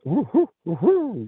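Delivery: high, rising owl hoots, the last one way up. Retold or imitated. Describing the owl sound to the host, high hooting owl imitation. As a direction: hoot like a barred owl, climbing into falsetto. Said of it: "how a barred owl has that".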